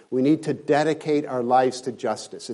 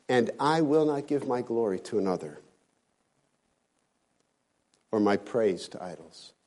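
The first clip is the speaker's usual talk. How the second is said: hushed; 3 semitones lower, 5 dB quieter than usual. Acted. Drops to softer solemn quote.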